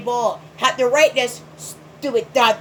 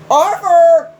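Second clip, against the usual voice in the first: exasperated shout; 4 semitones higher, 8 dB louder than usual. Real, not acted. Exasperated at Arthur's actions.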